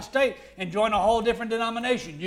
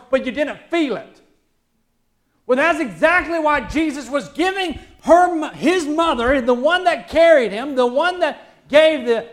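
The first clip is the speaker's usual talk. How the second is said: raised, high-pitched preacher's voice; impassioned preaching; 7 semitones higher, 8 dB louder than usual. Real, not acted. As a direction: drive the point home loudly to the congregation. Preaches at full force.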